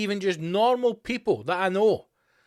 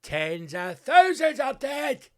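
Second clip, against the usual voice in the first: slow, much higher furious shout of tens of thousands; outraged shout; 9 semitones higher, 4 dB louder, much slower than usual. Real, not acted. Comic ranting about the covid dead, slow furious shout. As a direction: drop the sarcasm and yell it out.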